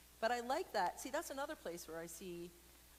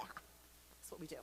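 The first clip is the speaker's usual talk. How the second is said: soft, slow whisper; slow whispered aside to the audience; 5 semitones lower, 11 dB quieter, slower than usual. Real, not acted. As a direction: say it under your breath.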